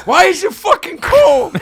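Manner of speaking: high, slow, loud outrage; outraged yell. Retold or imitated. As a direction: yell it, appalled. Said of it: "And he goes".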